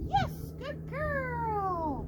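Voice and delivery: slow, high, sing-song praise for the dog; drawn-out praise. Real, not acted. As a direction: stretch out the praise to reward her.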